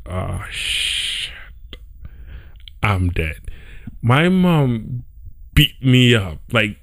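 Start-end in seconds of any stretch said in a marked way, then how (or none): 0.1-3.4 hushed, dropping to a low murmur; dread. Retold or imitated. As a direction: sink into quiet dread, caught red-handed. Caught by mom, dreading punishment.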